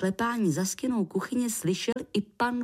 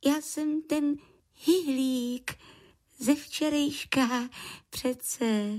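high-pitched little creature voice; creature introducing himself; 7 semitones higher, slower than usual. A narrator's reading. Lichožrout reminds puzzled man who he is, high creature voice. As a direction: introduce yourself in a small high voice. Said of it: "pro jistotu představil".

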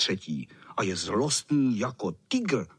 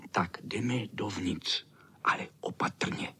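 whisper; 4 semitones lower, 4 dB quieter than usual. A narrator's reading. Boys creep into the cat's garden, whispering. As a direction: whisper it, careful not to be heard.

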